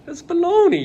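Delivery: high, hammed-up Italian exclamation; indignant mock-Italian outburst; Francesco Redi. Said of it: "Francesco Redi was like".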